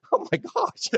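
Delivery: stunned that she'd threaten you; high, breathy oh my gosh; high shocked gasp. Retold or imitated. Reacting after being threatened with a gun.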